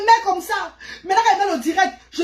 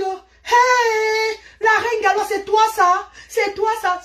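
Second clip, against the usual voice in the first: imitating admiring fans; 8 semitones higher, much slower than usual. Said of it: "Les gens sont venus dire que".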